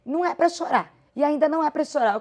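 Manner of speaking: mother scolding her crying child, mock stern; imitated mother scolding. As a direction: play the mom who won't allow crying. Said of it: "seja minha mãe".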